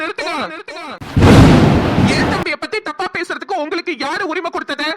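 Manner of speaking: angry defence. Acted. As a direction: defend your brother, furious. Defends brother against insults.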